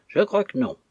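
shouted reply. A narrator's reading. Young mate answering the steamer from forward, shouted reply. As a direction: call the answer across to the other ship. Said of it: "et je leur criai".

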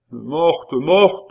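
loud, high cry of grief, building; bereaved baron's wail; grief-stricken repetition. A narrator's reading. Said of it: "répéta le baron".

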